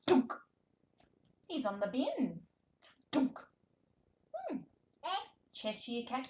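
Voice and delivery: high, animated picture-book voice with big gasps; reading to a toddler. Shown as a demonstration. Speech therapist reading to toddler, animated and sing-song. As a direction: read it like a thrilling show for a baby.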